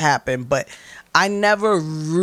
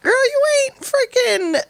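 sassy tough love; 11 semitones higher than usual. Retold or imitated. Telling her angry self to admit she is hurt, sassy and high. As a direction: talk sense into yourself like a blunt best friend, pitched way up.

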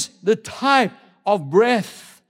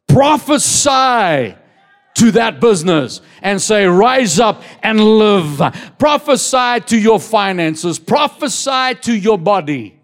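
preaching shout. Real, not acted. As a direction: proclaim it over the congregation. Preaching at full force.

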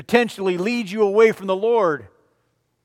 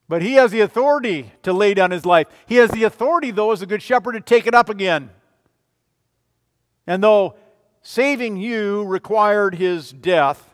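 louder, faster preaching burst; 3 semitones higher, 6 dB louder than usual. Acted. Pastor rousing the congregation, louder preaching. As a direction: proclaim it to the congregation. Pastor proclaims Christ's authority.

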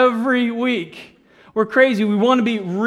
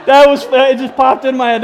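louder, higher, laughing through words; 4 semitones higher, 9 dB louder than usual. Real, not acted. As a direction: talk through a real laugh. Laughing at own joke.

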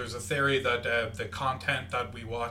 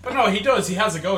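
excited; 6 semitones higher, 7 dB louder than usual. Real, not acted. Excited insistence, louder and higher.

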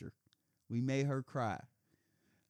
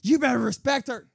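higher, louder imitated yell; mock yelling husband; 10 semitones higher, 9 dB louder than usual. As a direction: yell like the husband scolding kids. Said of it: "yelling at us".

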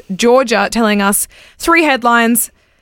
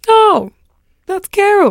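TikTok sound; 10 semitones higher, slower than usual. Retold or imitated. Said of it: "that awesome TikTok noise where it's like".

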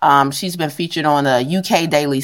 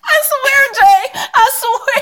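high, swearing it's true through laughter; half crying with laughter; laughing insistence; slower than usual. Real, not acted. Insisting while laughing at story.

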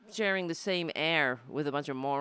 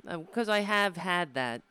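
normal talking voice

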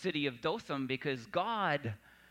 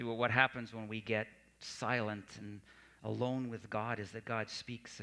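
ordinary delivery

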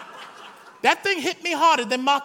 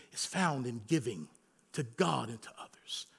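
much softer, whispered close; hushed whisper; 14 semitones lower, 14 dB quieter than usual. Real, not acted. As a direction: bring it down to a whisper. Closing, dropping to whisper.

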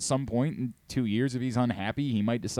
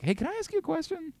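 high, softened voice for a polite request; retold tentative approach; 5 dB quieter than usual. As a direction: quote yourself timidly, pitched high.

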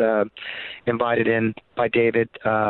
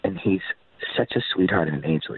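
usual speaking voice

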